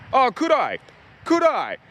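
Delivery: high mocking "could I", sharp and sarcastic; cranky sarcastic retort. Retold to a Centrelink agent, high sarcastic scorn. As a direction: throw the question back with scorn. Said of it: "then I get cranky and say".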